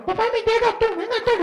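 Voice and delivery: put-on very high child crying out to his dad; a small boy wailing for his dad; child's panic voice. A frightened boy calling to his dad about a thief.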